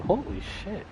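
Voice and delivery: ordinary talk, nothing marked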